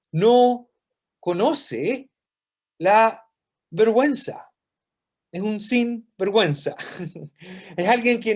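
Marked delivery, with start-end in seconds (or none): 0.1-4.5 no conoce la vergüenza said word by word with pauses, no punched loud. Shown as a demonstration. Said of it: "word by word emphasis".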